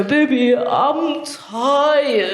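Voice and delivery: high, drawn-out whine to a partner; adult putting on a toddler's tired voice; slow, babyish plea for sympathy; baby talk. Acted. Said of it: "baby talk in a relationship".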